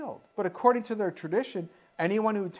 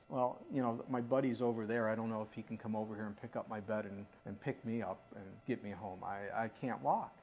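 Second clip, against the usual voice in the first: mumbled excuses; 9 semitones lower, 10 dB quieter than usual. Acted. Voices the man's excuses.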